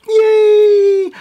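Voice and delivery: high, loud gleeful cheer; excited yay. Real, not acted. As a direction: squeal it with delight. Happy with beauty box.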